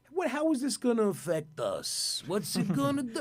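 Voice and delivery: high-pitched mock naive dismissal; scoffing disbelief. Band scoffing at the coming hurricane, high and dismissive.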